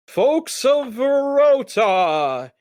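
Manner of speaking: high, slow, booming ring announcer; magically amplified host calling the crowd; loud booming announcer. Acted. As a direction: boom it out to the whole crowd. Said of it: "he says in a loud, booming voice".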